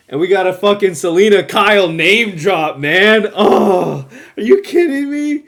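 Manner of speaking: thrilled outburst. Real, not acted. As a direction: burst out in fan excitement. Thrilled by name drop.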